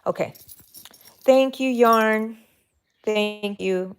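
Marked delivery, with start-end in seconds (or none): 1.2-4.0 high, slow, sing-song thank you; playful thanks. Pretend. Crocheter done with the skein, thanking it in a high slow sing-song. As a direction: thank the yarn like an old pal.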